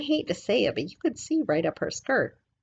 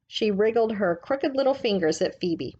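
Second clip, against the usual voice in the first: usual speaking voice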